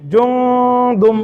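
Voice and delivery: জুমদুম with the ন hidden in a long nasal hum; nasal ghunna. Shown as a demonstration. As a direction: stretch জুমদুম slowly, humming through the nose. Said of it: "গুণনা করে". (নাক দিয়ে গুণনা করে জুমদুম)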